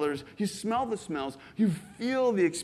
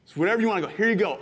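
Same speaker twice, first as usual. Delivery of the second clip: ordinary delivery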